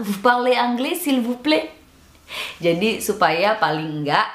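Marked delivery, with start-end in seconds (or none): 0.1-1.7 high-pitched pleading request; lightly pitiful, rising plea; pleading demo. Shown as a demonstration. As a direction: beg a stranger for help. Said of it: "Dengan muka memelas".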